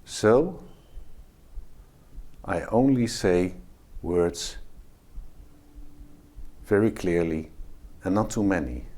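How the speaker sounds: slow words with long pauses between; calm and measured, without yelling; slow and deliberate. Shown as a demonstration. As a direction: let the silence carry authority. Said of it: "the silence is very important too".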